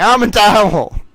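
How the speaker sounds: loud, high mock yell; yelling back. Yelling back at Murder by Maestro, loud and high. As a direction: shout it right back at him. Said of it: "and then I just yell back".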